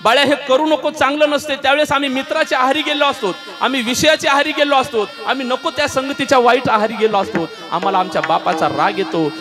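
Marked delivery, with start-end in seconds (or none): 0.0-7.5 raised, higher preaching cry; impassioned sermon peak; preaching at full force. Acted. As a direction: drive the warning home to the crowd. Preaching climax about fathers.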